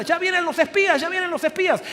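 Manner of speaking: high-pitched excited cry, not full volume; mock children's shouting. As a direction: imitate kids calling out the news. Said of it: "se empiezan a gritar". (gritos de niños imitados, agudos)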